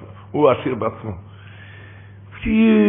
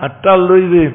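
ordinary talk, nothing marked

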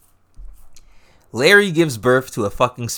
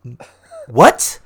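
loud, incredulous outburst; mock-appalled, rising question; retold stunned disbelief; 7 dB louder, much slower than usual. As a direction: react in loud, sputtering disbelief. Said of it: "her reaction is".

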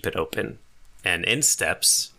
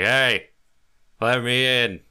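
a hint of a drunk, higher than usual; lightly voiced drunk demanding entry; drunken man; 4 semitones higher, 3 dB louder than usual. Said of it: "He's obviously drunk early in the morning".

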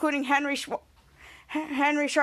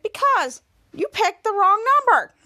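voiced teacher; 6 semitones higher, 5 dB louder than usual. Teacher explaining.

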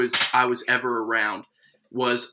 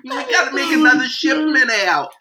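Jersey baker voice; 11 semitones higher than usual. Pretend. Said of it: "the cake boss".

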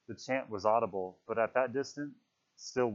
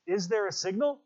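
higher, anxious asking; anxious question; 10 semitones higher, 3 dB louder than usual. Acted. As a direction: press him, worried, for an answer. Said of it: "said Piggy anxiously".

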